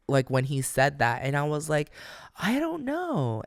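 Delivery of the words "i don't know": very high, drawn-out doubtful squeak; mock uncertainty. Founder doubting the CEO's promise, high mock-uncertain squeak. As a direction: squeak out exaggerated self-doubt, slowly. Acting out self-doubt at big offer.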